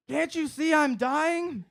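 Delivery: high, slow, dramatic whine; desperate whine. Retold or imitated. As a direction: wail that you're dying. Panicking about dying.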